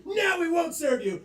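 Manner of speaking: high, loud mock refusal; hammed-up bartender putting a foot down; acted refusal. Bartender flip-flopping, higher refusing voice.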